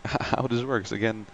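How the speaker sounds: usual speaking voice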